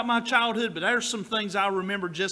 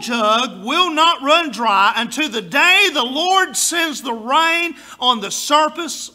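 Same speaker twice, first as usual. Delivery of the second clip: slow, loud preached scripture; preaching; 3 semitones higher, 8 dB louder, slower than usual. Acted. Pastor preaching scripture to congregation, slow and loud. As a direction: proclaim it from the pulpit.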